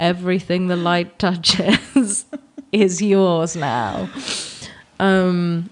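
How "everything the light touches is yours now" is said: slow, solemn mock-majestic father; Lion King dad voice. Joking about a dad on the ceiling, slow mock-solemn voice. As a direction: intone it slowly like a ghostly king. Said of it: "your dad's on the ceiling".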